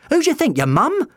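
high, loud sarcastic comeback; shouted retort. A narrator's reading. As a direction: throw it back exasperated and high. Doctor retorts amid the alarm.

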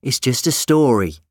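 curt and dismissive; abrupt. A narrator's reading. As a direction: cut the talk off abruptly. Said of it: "Sally said abruptly".

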